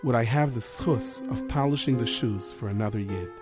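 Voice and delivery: gentle Rav voice. Acted.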